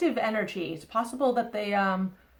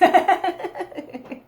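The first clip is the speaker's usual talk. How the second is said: bright, high burst of laughter; 7 semitones higher, 3 dB louder than usual. Real, not acted. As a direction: laugh out loud at herself. Laughs after wishing to meet someone.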